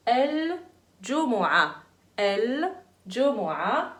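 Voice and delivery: الجمعة said slowly, the ʕ clear and no final ta; slow model pronunciation. Shown as a demonstration. Teacher models الجمعة slowly for the children. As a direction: stretch out الجمعة, sounding the ʕ. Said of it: "C'est harf al-ain".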